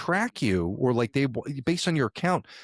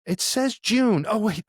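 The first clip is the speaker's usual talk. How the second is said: stretched, high "It says June"; high, slow mom; 11 semitones higher, 3 dB louder, slower than usual. Retold or imitated. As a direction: mom slowly reads out the wrong date, pitched up. Mom reading the old text.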